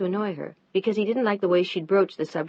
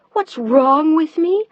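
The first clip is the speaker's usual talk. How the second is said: higher, louder, slow outraged repeat; slower, higher and louder again; mounting outrage; 9 semitones higher, 9 dB louder, slower than usual. Acted. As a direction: push it even higher.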